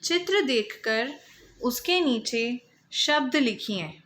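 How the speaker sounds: ordinary delivery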